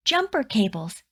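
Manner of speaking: ordinary delivery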